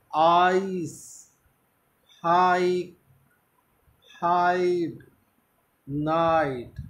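British "आइस", "हाइक", with a long stressed आ; British English "हाइड", its आ drawn out. Shown as a demonstration. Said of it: "आ को थोड़ा लंबा खींचना है". (British उच्चारण में "आइस", आ खींचकर)

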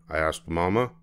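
ordinary delivery, nothing marked

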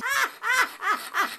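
very high, staccato villain laugh; unhinged high-pitched laughter; manic giggle. Acted. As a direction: cackle girlishly at your own tease. Stalker laughs after teasing.

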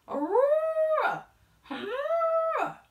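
very high, loud, slow trumpeting; huge elephant blast. A narrator's reading.

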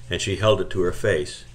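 usual speaking voice

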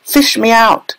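put-on high-pitched boy's shout; high, desperate cry; panicked yell. A narrator's reading. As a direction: yell for rescue from the river. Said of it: "he yelled".

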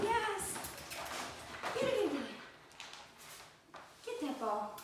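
high, eager yes and get it; excited dog cue. Real, not acted. Trainer sending puppy after the ball, excited. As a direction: pump the puppy up. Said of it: "keep your voice excited".

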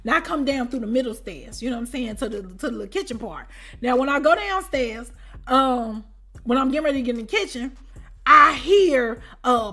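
ordinary talk

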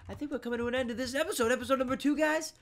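high, fast, loud episode wrap-up; excited, high-pitched sign-off; hyped announcement. Real, not acted.

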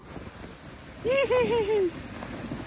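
breathless high laughter; laughing down the hill; sledding downhill. Real, not acted.

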